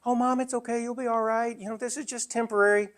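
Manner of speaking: high, falsely soothing voice; placating reassurance. Retold or imitated. Son reassuring his mother in denial, high and falsely soothing. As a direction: soothe her like it's all fine.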